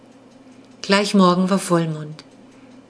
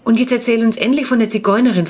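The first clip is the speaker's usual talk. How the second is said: higher, louder, fast and pushing; eager demand; 5 semitones higher, 5 dB louder than usual. A narrator's reading. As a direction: urge him to finally spill it. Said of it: "forderte Jonas Ferdi auf".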